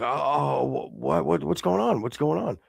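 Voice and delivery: imitating a flustered, clueless man; flustered innocence. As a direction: act caught off guard, tripping over words. Retells man caught off guard, stammering.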